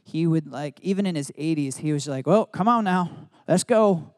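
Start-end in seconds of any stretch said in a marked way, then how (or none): 2.3-4.1 loud, high imitation of a Southern grandfather hurrying everyone along; mock an old Tennessee man urging them out the door; hammed-up Southern grandpa, loud and high. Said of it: "He had a deep Southern accent".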